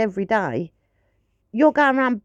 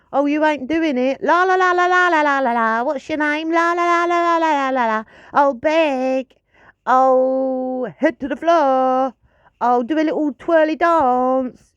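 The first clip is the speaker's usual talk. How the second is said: much higher, louder gushing la-la chatter; fussy dog-owner babble; 5 semitones higher, 4 dB louder than usual. Retold or imitated. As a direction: gush endlessly at the pet. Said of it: "I don't keep going".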